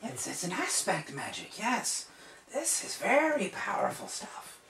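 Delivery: very high, loud, squeaky wizard voice; excited old wizard. Acted. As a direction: gush like a frantic little sorcerer. Saul examining the marks.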